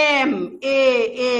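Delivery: এম split into its sounds এ then ম, the এ held out; teacher stretching a letter name into sounds; sounding out M. Shown as a demonstration. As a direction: break এম apart so the এ sound is heard first. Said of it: "এ পাচ্ছি আমি".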